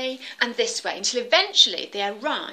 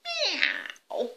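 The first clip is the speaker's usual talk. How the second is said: high storybook cat miaow; cat meow; 13 semitones higher than usual. Acted. Cat appearing in the kitchen, high miaow. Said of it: "came the cat".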